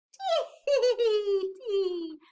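loud, tittering penguin laugh; acted storybook tee-hee giggle; high-pitched giggle. A narrator's reading.

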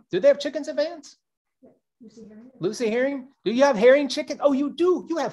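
high, fast, eager questions; excited call-out. Real, not acted. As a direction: call out to the crowd brightly. Calling out to audience member.

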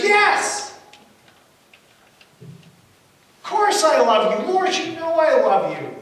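loud, high, insistent declaration; acted pleading shout, stretching the words; emphatic protest. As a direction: cry it out with full conviction. Acts Peter's insistent declaration of love.

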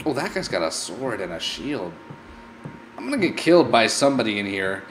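ordinary delivery

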